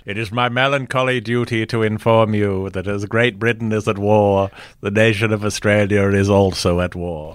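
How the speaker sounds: put-on clipped, old-fashioned British-tinged Australian accent; stiff, formal old Australian accent. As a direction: read it like a 1930s Australian prime minister on the wireless. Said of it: "that clipped British accent".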